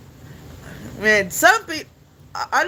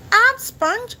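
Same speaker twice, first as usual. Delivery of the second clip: high cartoon character voice; SpongeBob; 14 semitones higher than usual. Streamer trying a cartoon impression, high-pitched voice. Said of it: "I know how to do SpongeBob".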